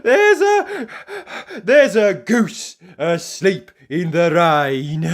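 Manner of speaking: high, loud, stammering in a rush; flustered babble. Acted. Mister Forgetful garbling his message to the farmer, loud and flustered. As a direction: stumble over the message.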